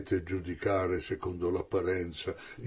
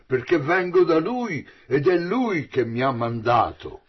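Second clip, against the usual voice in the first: proclamato forte, molto più acuto all'inizio; a voce alta; 3 semitones higher, 8 dB louder than usual. Acted. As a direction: annuncia a tutta voce alla folla del tempio. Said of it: "esclamò a voce alta". (ad alta voce, proclamando)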